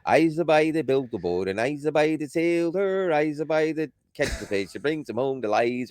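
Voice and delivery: put-on lightly sung Newfoundland accent, "by" for boy; half-sing it in thick Newfoundland dialect. Reciting a Newfoundland folk song in local accent. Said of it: "It sounds like this".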